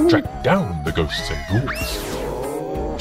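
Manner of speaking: plain reading voice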